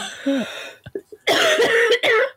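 long hacking coughing fit. Real, not acted. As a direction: cough hard until it clears. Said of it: "I'll have a coughing fit".

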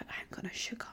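whispered guilty confession; barely voiced whisper, mock ashamed. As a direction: breathe it out as a sheepish whisper. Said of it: "So I was like".

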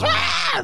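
high unvoiced mock shriek; a hammed-up breathy shriek; mock scream. Imitating the girlfriend being attacked, a mock shriek.

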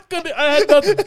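high, loud laughing confession; cracked up, voice jumping high. Real, not acted. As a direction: talk through a real laughing fit. Cracking up after a joke.

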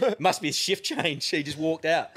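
slow, high words broken by a laugh; laughing through it. Retold or imitated. Retelling his excuse to the team, laughing through it. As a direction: laugh as you deliver the excuse.

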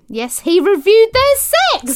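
high, loud, drawn-out incredulous reveal; incredulous announcement. Acted. Reader reveals the sex review to co-host, in an incredulous shout. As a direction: shout the scandal out slowly. Scandalised reveal while reading listener's story.

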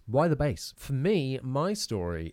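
ordinary talk

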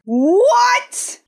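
high, stunned cry of disbelief; shocked cry. Real, not acted. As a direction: yelp it out in shock. Shock at the reveal.

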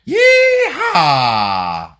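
loud, high yeehaw shout; long hammed-up cowboy holler. Joins the cowboy joke.

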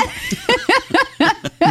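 shrill burst of laughter; helpless high-pitched laugh; high laughing squeal. Real, not acted. Laughing at creepy voice.

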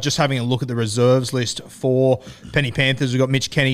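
usual speaking voice

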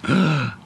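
sharp, high imitated gasp; mock shocked gasp. Kid spotting someone famous at a convention, mock gasp. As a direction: gasp as if struck by recognition. Acts out kid's shocked recognition.

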